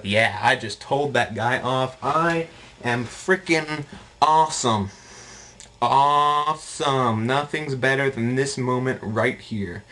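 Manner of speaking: slow, drawn-out mock triumph; hammed-up bragging, stretched for effect; self-satisfied gloating. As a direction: savor every word like a champion. Acting out his gloating triumph.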